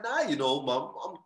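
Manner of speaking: high-pitched, over-innocent small talk; feigned breeziness. Retold or imitated. Kicked-out son distracts his mum with small talk, falsely breezy. Son stalling to dodge mum's question.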